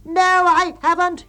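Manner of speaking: high, loud, slow defiant yell; loud, high drawn-out contradiction; yelled denial. A narrator's reading. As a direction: yell back stubbornly. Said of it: "he yells back".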